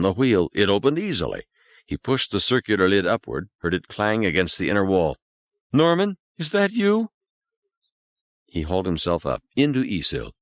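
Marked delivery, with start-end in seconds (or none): 5.7-7.1 high, anxious woman's voice; Beth calling. Acted. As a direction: call out hopefully.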